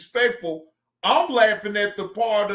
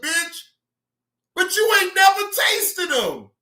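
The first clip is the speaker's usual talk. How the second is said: high-pitched disbelieving shout; raised mocking yell; incredulous rant; 7 semitones higher than usual. Real, not acted.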